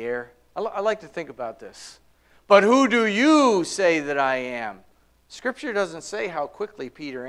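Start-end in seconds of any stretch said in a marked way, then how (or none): 2.5-4.8 long, raised "you" in a slow question; stress on "you". Retold or imitated. Jesus' question left lingering in the air, "you" stressed. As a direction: stretch out "you" and let the rest fall. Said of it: "hear him emphasizing the word you".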